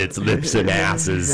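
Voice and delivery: slow deadpan. Acted. Mock serious claim, slow.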